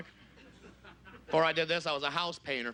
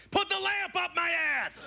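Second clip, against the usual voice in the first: high-pitched drawn-out scream; screamer; 14 semitones higher, much slower than usual. Acted. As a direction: scream it out high, stretching the words. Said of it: "screamers and yellers".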